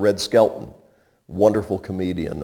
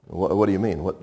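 usual speaking voice

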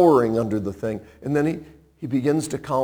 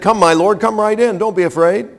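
urgent welcome; 6 semitones higher, 4 dB louder, faster than usual. Acted. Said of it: "Jael went out to meet him".

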